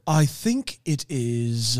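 slow, low, affected taster; mock fancy talk. Co-host imitates long-winded fancy tasters, slow mock fancy. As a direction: draw it out softly, pretending to be fancy. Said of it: "everybody's trying to be fancy".

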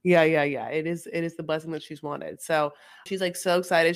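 ordinary delivery

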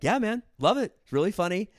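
high, loud, upbeat mock Nick voice; hammed-up cheerful praise; imitating Nick. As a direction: hype him up like a cheery boss. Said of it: "he's like".

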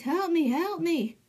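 high, slow, drawn-out plea; pleading king. Retold or imitated. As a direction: whimper for help like a stuck king. King stuck in the marsh.

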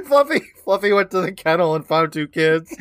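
high, loud, laughing words; cracking up. Real, not acted. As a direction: blurt it out through real laughter. Cracking up over absurd riff.

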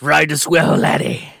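gruff pirate growl, loud. Retold or imitated. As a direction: ham it up like a pirate. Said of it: "some kind of pirate at the end there".

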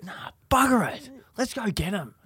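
mildly acted fired-up resolve, pitch jumping up; angry. Little brother hearing the theft story, angry call to give chase. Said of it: "he gets really angry".

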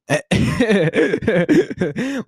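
long, high peal of laughter; amused laugh. Real, not acted. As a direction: burst out laughing at yourself. Laughing at her tiny drama.

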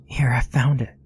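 unvoiced excited whisper. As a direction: breathe it out in secret. Found gun in hiding.

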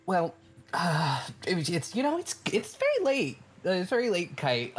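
high, stammering, flustered guard; flustered gatekeeper. Acted. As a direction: squeak it out, caught off guard. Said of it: "Pythios is like".

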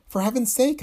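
high startled exclamation; alarmed cry. Acted. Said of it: "I cried".

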